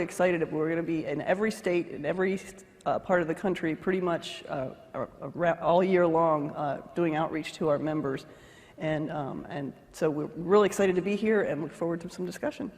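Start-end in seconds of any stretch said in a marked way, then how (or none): none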